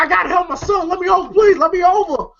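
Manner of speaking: high, urgent begging; desperate pleading. Retold or imitated. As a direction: plead to be let through. Father begging in traffic.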